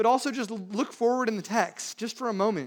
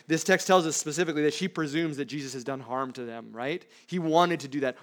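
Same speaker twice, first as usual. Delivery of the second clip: ordinary delivery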